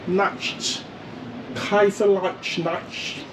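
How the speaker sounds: NACHT with a hushy SH before the T; slow, tentative German Kaiserreich Nacht; attempted German pronunciation. Shown as a demonstration. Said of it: "trying to pronounce all these German words".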